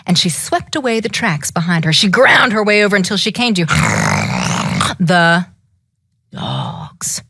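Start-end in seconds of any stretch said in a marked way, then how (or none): 3.7-4.9 quick surprised intake of breath; sharp gasp. Acted. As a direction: gasp as the dogs appear. Storyteller gasps at the dogs.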